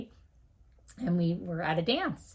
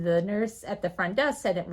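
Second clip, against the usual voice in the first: ordinary talk, nothing marked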